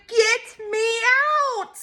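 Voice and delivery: put-on high, stretched-out toddler yell; mock shout. A narrator's reading. Wriggling child wanting out, high mock shouting. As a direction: cry out like a trapped child. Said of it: "and then I shouted".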